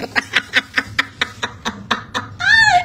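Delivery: long shrieking laugh; real helpless laughter. Real, not acted. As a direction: burst out cackling. Cackling at viewer comments.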